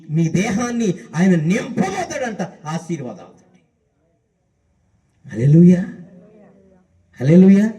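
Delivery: ordinary talk, nothing marked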